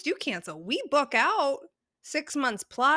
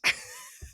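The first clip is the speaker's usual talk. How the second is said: high laugh; 4 dB quieter than usual. Real, not acted.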